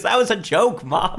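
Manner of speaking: high-pitched mock protest to mom; exasperated daughter. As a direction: imitate a teen protesting, pitch jumping up. Acts out daughter protesting to mother.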